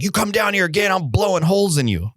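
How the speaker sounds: retold heated warning; angry threat. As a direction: imitate a fired-up landowner warning someone off. Landowner threatening the trespasser.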